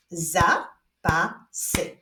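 very slow, each syllable separated; broken into syllables with clear pauses. Shown as a demonstration. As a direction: clap out the syllables one at a time. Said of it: "Wyklaszczmy sylaby w wyrazie zapasy".

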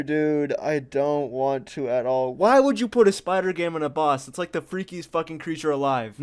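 ordinary delivery

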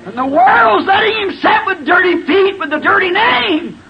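high, anguished pulpit shout; impassioned preaching. Real, not acted. Preacher lamenting how the world treats Jesus, impassioned shout. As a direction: cry it out high to the congregation.

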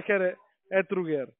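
ordinary talk

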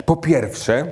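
ordinary delivery

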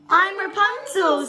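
put-on a young girl introducing herself; voicing Rapunzel. A narrator's reading. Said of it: "said the girl".

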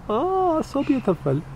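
high delighted oh, then soft; soft delighted coo. Acted. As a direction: melt over the tiny teeth. Admiring bat's teeth.

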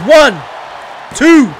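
wrestling commentator. Acted. Calls the match live.

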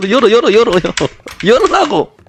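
high frantic shout to open up. Real, not acted. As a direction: yell in real panic. Desperate to get the door open.

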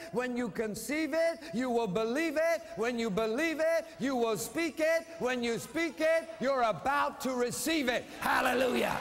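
rising into a shouted hallelujah; fiery preacher climax; preaching at full force. Acted. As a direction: build the preaching to a loud shout. Building preaching chant.

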